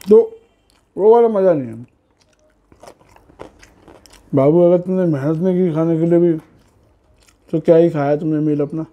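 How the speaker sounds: speaking between bites; talking while chewing. Real, not acted. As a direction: mouth partly full of food. Seen 0: Chewing after bites at -3.0 and +1.7, talks with food in mouth.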